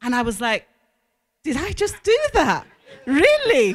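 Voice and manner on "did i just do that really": laughing disbelief. Real, not acted. Amazed at herself, laughing.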